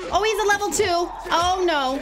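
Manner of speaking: high, loud cry of alarm. Real, not acted. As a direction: yelp it in dismay as danger appears. Alarmed at enemy in game.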